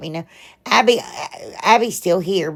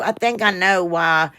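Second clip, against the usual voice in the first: usual speaking voice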